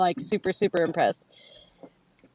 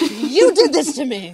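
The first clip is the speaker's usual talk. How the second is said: mock accusation; 6 semitones higher, 4 dB louder than usual. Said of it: "I'm like".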